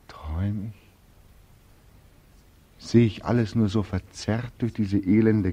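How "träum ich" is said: whispered, half to himself; dazed whisper. Acted. Beckmann asks himself if he dreams, whispered. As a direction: breathe the question as if still dreaming.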